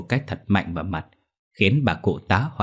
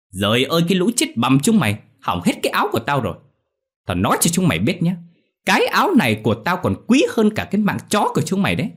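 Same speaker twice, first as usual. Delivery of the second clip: fast, high, loud tirade; enraged ranting; 9 semitones higher, 5 dB louder, faster than usual. A narrator's reading. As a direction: rage over the ruined dress. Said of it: "mụ Thảo điên tiết".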